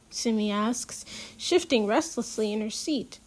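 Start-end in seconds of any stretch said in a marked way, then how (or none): none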